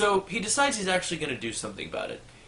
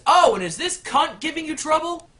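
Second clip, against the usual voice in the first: much higher, louder eager suck-up; fawning manager; 13 semitones higher, 7 dB louder than usual. Acted. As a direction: gush to the rich customer. Manager fawns on millionaire.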